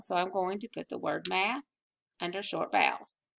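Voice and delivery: ordinary talk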